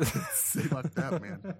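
slow, raspy and sore; hoarse throat. Real, not acted. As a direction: speak through a sore throat. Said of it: "my throat's a little fucked".

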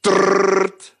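loud rolled machine rattle; buzzing mechanical noise; imitated rattle. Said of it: "es geht so".

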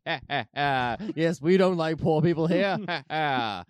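put-on hearty old colonel with a fake laugh; mock haughty chortle, loud in the middle; blustering colonel grandfather. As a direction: play a smug old colonel. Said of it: "his grandfather, the colonel, was like".

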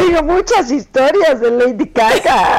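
shaky high voice, cracking with laughter; laughing through pain. Retold or imitated. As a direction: quote it while fighting back laughter. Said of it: "No me hagas reír".

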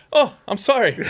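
high-pitched imitated apology; startled apology. Wife's hit, startled apology acted.

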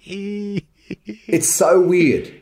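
exasperated, high and drawn out; aggrieved, voice climbing high; upset. Real, not acted. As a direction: stretch it out in high-pitched bafflement. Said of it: "it sounds like you're upset".

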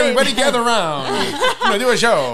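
loud, high street barker call; ringmaster. Pretend. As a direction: call the crowd like a showman. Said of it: "Like Bert and, uh, Mary Poppins".

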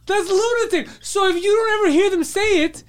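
very high, strained disbelief; incredulous outburst. Real, not acted. Exasperated argument over a false belief.

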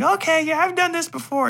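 high mock child voice; kid voice. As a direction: chirp it like a confident little kid. The kid answering.

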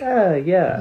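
bright satisfied "ah, yeah"; delighted at the outfit; pleased exclamation. Retold or imitated. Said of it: "be like".

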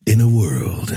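close-miked trailer announcer voice; movie announcer. Shown as a demonstration. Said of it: "gotta get really close for this".

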